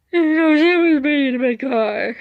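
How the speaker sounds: high, loud tearful wail, acted out; mock crying. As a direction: wail it like a panicked emergency caller. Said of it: "I was doing this while crying".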